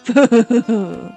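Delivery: gloating little laugh; sinister chuckle. Acted. Killer about to confess, sinister chuckle.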